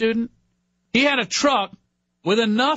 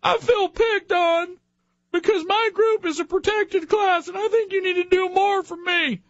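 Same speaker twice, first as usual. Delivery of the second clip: high, mock self-pitying whine; sniveling complaint; whiny victim voice; 11 semitones higher than usual. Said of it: "most of our political discussion comes down to".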